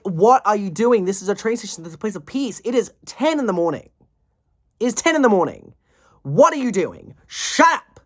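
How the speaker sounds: exasperated scolding, building to a high shout; mad, fuming. Retold or imitated. Commuter scolding girls at train station, fuming. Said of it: "if I'm mad, they're fuming".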